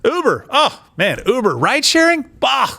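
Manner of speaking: high, excited mock gushing over the idea; mock delighted official. Mocking a thief who grabs the idea, gushing high. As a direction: ham up a greedy official who loves the idea.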